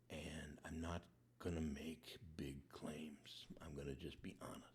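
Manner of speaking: whispered, soft and slow; hushed honest whisper. Acted. Peter finally honest with the Lord, hushed whisper.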